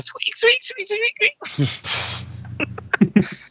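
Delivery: tiny iguana squeaks, very high; rubbery high squeaky toy. Acted. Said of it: "a teeny little iguana going".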